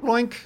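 high squeaky cartoon bloink; a silly high pop sound; playful bloink noise. Real, not acted.

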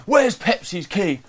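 high, drawn-out mock drunk demand; aggressive drunk. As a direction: imitate a drunk mate barging in aggressively. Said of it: "he gets a bit aggressive when he's drunk".

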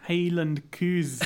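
drawn-out attempt at Scottish vowels; hesitant host failing at a Scottish accent; bad Scottish accent. Podcaster supposed to be Scottish trying his accent, badly faked Scottish. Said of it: "I can't do a Scottish accent".

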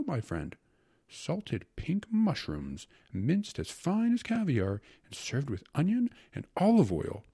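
carried-away epicure, high swooping relish; rapturous secretary. Acted. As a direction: lilt high on each delicacy, savoring it. Secretary forgets himself praising appetizers.

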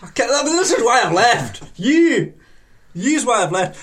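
mock-indignant Yorkshire rant; Yorkshire accent. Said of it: "needs a bit more of a Yorkshire accent".

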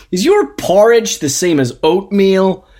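put-on high, loud brash American accent; a confident American asking loudly. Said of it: "There were loads of Americans".

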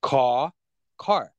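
American, South African, then Australian "car"; "car" said three ways in a row; three accents of car. Shown as a demonstration. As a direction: run "car" through three accents in turn. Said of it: "So it's car, car, car".

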